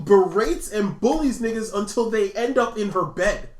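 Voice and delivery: ordinary talk, nothing marked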